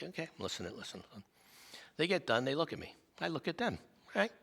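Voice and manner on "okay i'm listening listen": much softer, half under the breath; hushed aside. Retold or imitated. Sitting with the arguing couple, quietly to himself. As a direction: murmur it to yourself.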